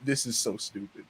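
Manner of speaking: deadpan, low and soft; understated deadpan dismissal; straight-faced disdain. Retold or imitated. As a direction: imitate someone unimpressed, keeping it quiet and deadpan. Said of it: "like, a straight face".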